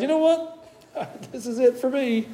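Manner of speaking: high, laughing, giving-up voice; fed-up prophet. Retold or imitated. Preacher voicing the worn-out Jeremiah, high and half laughing. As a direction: play him throwing in the towel with a chuckle. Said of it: "why maybe he's saying".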